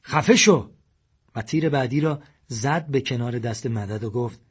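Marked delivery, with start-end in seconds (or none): 0.0-0.7 loud, high bark of a command; angry shout to silence Madad. A narrator's reading. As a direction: yell him down. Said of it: "ساسان داد زد".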